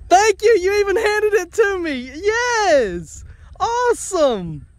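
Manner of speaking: high, delighted praise; thrilled. Real, not acted. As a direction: gush over the goat. Goat hands back the can.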